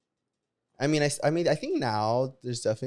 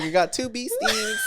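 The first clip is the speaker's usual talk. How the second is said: laughing quote; slower than usual. Retold or imitated. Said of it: "I'm like".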